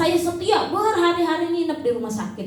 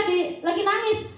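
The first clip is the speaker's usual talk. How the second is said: usual speaking voice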